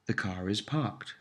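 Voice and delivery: R sounded in car before "is"; linking R. Shown as a demonstration. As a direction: link car to is with an R. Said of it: "except when a vowel follows".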